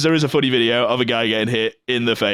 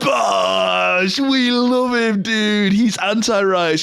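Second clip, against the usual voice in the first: high, drawn-out fan cry; delighted cheer; 8 semitones higher, much slower than usual. Real, not acted. Cheering Bosch Man after watching his clip, delighted cheer. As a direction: celebrate him with a long, high whoop.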